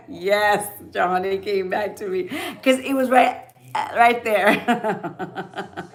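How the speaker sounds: loud, amused, breaking into laughter; laughing delight. Real, not acted. Joking that Johnny came back to her, laughing. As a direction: let the laughter take over the last words.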